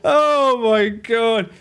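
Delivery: drawn out slowly through laughter; slow amazed laughing groan; laughing disbelief. Real, not acted. Streamer cracking up at the ad, slow laughing disbelief.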